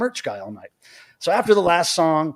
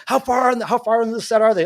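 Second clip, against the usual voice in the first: excited asking; 7 semitones higher, 3 dB louder than usual. Retold or imitated. Excited fans asking at door.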